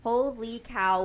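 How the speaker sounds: usual speaking voice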